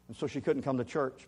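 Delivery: normal talking voice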